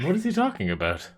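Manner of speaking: fast, high baffled question; confused outburst. Real, not acted. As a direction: blurt out bewildered.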